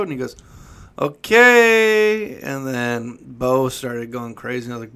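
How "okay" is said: high, loud, long-held yell; imitated screaming. Shop guy answering a snack run, imitated screaming. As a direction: mimic the shopkeeper hollering one stretched word. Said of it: "then he screams at Bo".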